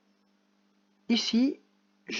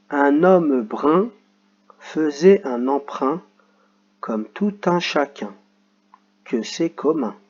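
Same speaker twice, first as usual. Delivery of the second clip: slow, louder read-aloud for pupils; model reading; 6 dB louder, slower than usual. Shown as a demonstration. Teacher reading the funny sentence to pupils, slow and clear. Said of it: "après je vous la lis".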